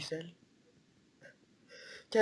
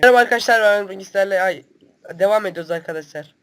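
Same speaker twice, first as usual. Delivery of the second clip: mock video intro; 2 semitones higher, 8 dB louder than usual. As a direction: kick off a fake new episode with gusto. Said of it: "hemen öbür video".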